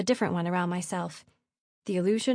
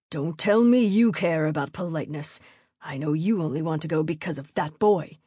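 lower, stern baron's voice; angry father; 4 semitones lower than usual. A narrator's reading. Posing as father, accusing daughter about a boy, angry. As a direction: scold as a disapproving father. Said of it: "something an angry father might say".

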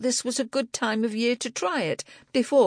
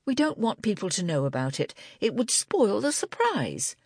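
higher-pitched, defiant explanation; suddenly defiant; 8 semitones higher than usual. A narrator's reading. Angela explaining the knitters' secret plan to Libby, defiant. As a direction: come back at them with sudden defiance, pitched up. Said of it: "suddenly defiant".

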